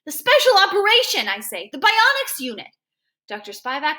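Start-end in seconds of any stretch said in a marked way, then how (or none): 0.0-1.3 high, loud protest; anguished insistence. A narrator's reading. Boy reminds doctor of promised operation, anguished. 1.7-2.7 anguished insistence. A narrator's reading. Boy reminds doctor of promised operation, anguished.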